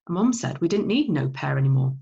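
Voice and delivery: usual speaking voice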